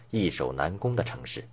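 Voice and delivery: plain reading voice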